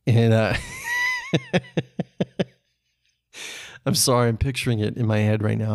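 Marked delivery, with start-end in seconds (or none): 0.6-2.4 high-pitched laughing fit; helpless laughter. Real, not acted. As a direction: crack up in squeaky, breathless laughter. Cracks up at his own impression.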